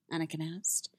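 usual speaking voice

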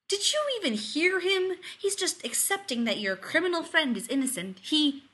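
high, loud protest; indignant. Acted. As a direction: protest in outraged disbelief. Rodney indignant at what he hears.

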